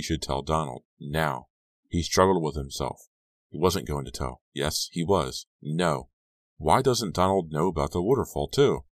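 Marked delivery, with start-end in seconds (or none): none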